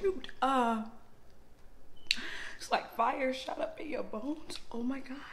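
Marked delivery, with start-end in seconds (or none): 2.6-5.2 breathless whisper from the heat; pained whisper. Real, not acted. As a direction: whisper through the burn. Spicy noodle pain, whispered.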